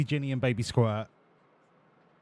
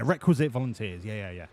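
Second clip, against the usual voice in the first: usual speaking voice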